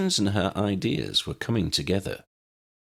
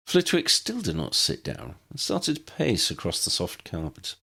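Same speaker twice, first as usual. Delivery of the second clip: plain reading voice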